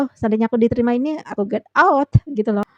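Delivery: ordinary delivery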